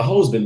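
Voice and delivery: HUSBAND with a long O, like "hose-band"; first syllable of HUSBAND rhymes with "rose"; punning mispronunciation. Real, not acted. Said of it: "I functioned like a husband, but I would date chicks".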